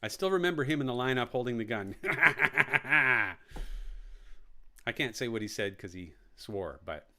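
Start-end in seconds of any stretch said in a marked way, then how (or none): 2.0-4.8 long rattling ha-ha-ha burst; staccato laugh in quick even pulses; imitated staccato cackle. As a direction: imitate a movie character's machine-gun cackle. Mimics lineup scene with gun.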